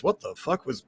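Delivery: soft little voice; quiet, softened bystander mutter; small voice. Acted. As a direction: say it small and hushed. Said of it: "You hear a small voice".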